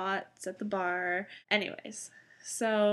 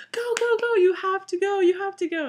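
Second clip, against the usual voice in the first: much higher, louder urgent shout; frantic yelling; 11 semitones higher, 8 dB louder than usual. Retold or imitated. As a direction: scream at the driver to drive. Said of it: "we're yelling at the cab driver".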